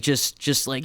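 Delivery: ordinary delivery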